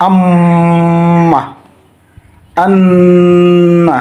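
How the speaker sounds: আম্মা and আন্না with the doubled m and n hummed long through the nose; held nasal ghunna. Shown as a demonstration. Quran teacher showing students ghunna in আম্মা, আন্না, slow nasal hum. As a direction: stretch the nasal sound in আম্মা and আন্না very slowly. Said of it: "যেমন".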